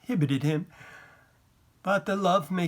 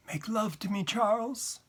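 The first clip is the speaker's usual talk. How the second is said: intimate plea; 7 semitones higher than usual. A narrator's reading. Wife to her husband in bed, tender and inviting. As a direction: ask tenderly in a raised pitch.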